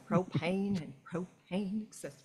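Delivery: soft, badly faked Texan cartoon-dad voice; bad Hank Hill. Showing off a bad impression for friends, soft Texan cartoon voice. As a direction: do a quiet, hammy Texas propane-salesman impression. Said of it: "I can do a bad".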